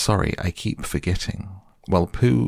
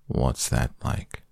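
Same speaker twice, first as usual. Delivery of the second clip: gloomy donkey; 5 semitones lower, 3 dB quieter, slower than usual. Acted. Eeyore voiced gloomily.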